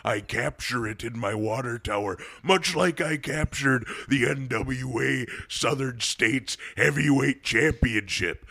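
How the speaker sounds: Jesse Ventura impression. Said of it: "went into Jesse Ventura".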